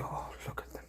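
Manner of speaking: put-on an adoring whisper at the kids; hushed whisper. Owner whispers fondly watching the cats, in a whisper. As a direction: breathe it out in a whisper, unvoiced. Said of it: "I just go".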